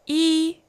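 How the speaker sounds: yi held high and level; first tone. Shown as a demonstration. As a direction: keep yi flat and high. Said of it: "First tone".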